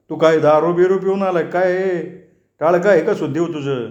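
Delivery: put-on a worried old man, scolding in disbelief; shocked old Chachaji.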